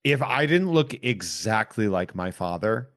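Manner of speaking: ordinary talk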